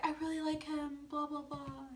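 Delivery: small soft voice drifting into blahs; mock smitten. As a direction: imitate a lovestruck woman dismissively. Mimicking the smitten Rachel dismissively.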